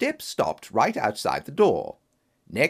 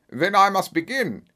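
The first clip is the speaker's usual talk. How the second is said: put-on formal, booming German-accented count; imposing German accent, measured and declamatory; strong German accent; 7 semitones higher, 4 dB louder than usual. A narrator's reading. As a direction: play a masked nobleman with a German accent. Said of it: "he asked in a strong German accent".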